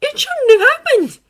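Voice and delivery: words spilling out uncontrollably; blurted. A narrator's reading. Izuku blurting his anger to All Might. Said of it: "Izuku blurted".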